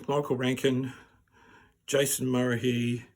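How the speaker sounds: normal talking voice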